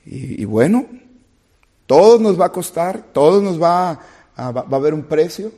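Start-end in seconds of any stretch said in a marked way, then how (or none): 1.8-5.5 preaching at full force. Acted.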